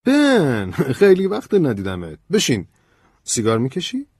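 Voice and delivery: loud, high, delighted greeting; excited. A narrator's reading. George greeting Ben after a long time, excited. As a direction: burst out warmly, high and loud. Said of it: "جورج هیجان زده گفت".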